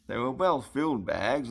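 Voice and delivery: usual speaking voice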